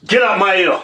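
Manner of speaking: loud, angry yell at the noise. Real, not acted. Yelling at his cohost to get out of his ear, loud. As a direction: yell it hard.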